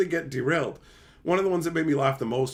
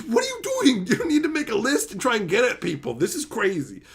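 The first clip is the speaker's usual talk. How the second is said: high incredulous what are you doing; disbelieving, high-pitched scolding of a friend; 6 semitones higher than usual. Retold or imitated.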